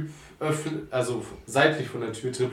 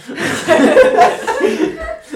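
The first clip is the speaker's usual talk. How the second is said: loud, high-pitched laugh; burst of laughter; 11 dB louder than usual. Real, not acted. Game master laughs at a player's dialect joke, real laughter.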